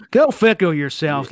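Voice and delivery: "Go fickle yourself" drawn out in a Southern drawl, each word stretched; slow country drawl. Shown as a demonstration. Host mimicking his own accent against the British co-host, slow Southern drawl. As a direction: say "Go fickle yourself" like a farmhand in a barn. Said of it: "like I'm in a barn".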